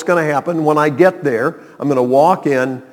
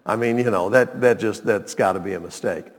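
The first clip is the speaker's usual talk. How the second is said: usual speaking voice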